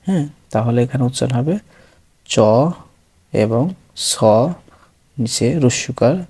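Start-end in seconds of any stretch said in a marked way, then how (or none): none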